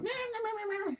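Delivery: high, furious mock cat meowing; angry cat hiss-meow. Wife going after the big guy, mock cat meows. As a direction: yowl at him like an attacking cat. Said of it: "attacking him and stuff".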